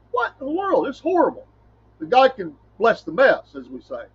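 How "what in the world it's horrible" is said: high, startled "what in the world"; a sudden high gasp of disbelief; stunned disbelief. Retold or imitated.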